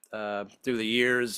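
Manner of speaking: ordinary talk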